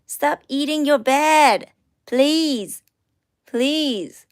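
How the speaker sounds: loud exasperated plea to stop; scolding pet. Real, not acted. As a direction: tell the pet off, drawn out. Scolding the pet for eating bed.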